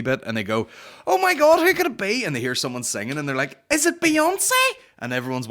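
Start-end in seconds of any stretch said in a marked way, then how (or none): 1.1-2.2 very high, loud mock excitement; hammed-up breathless guessing; hyped-up reaction. Mimics excited TV show reactions. 3.7-4.5 high, loud mock-amazed question; squealed fake wonder; hyped-up guessing. Mimics excited TV show guessing.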